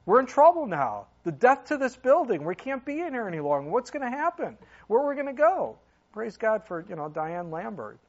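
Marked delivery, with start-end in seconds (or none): 0.1-5.8 high, loud, mock-alarmed outburst; retold panic. Pastor reliving being asked to leave the building, panicked. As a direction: act out frantic worry, pitched up and loud.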